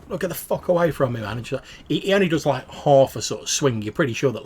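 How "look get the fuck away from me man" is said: higher, louder snarl to back off; angry warning. Acted. Stranger warning the junkie before the punch, angry raised voice. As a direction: push him away with your voice. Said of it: "And he's just like".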